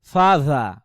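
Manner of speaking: ফাজা with a wet tongue tip. Shown as a demonstration. Teacher showing students the wet dh. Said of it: "মুখে লালাটা থাকবে জিহ্বার অগ্রভাগে".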